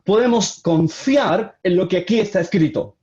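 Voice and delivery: usual speaking voice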